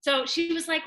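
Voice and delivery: ordinary delivery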